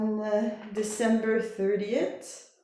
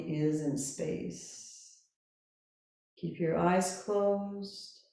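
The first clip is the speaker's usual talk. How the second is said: slow, calm Yoga Nidra guidance with long pauses; guided meditation; 3 semitones lower, 4 dB quieter than usual. Real, not acted. Yoga teacher settling listeners lying down, calm guided meditation. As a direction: guide them gently into rest, unhurried.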